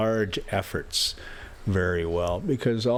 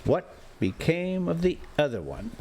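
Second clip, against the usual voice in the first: a sharp, pointed question; sharply; 7 semitones higher than usual. A narrator's reading. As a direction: demand it pointedly. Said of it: "said I sharply".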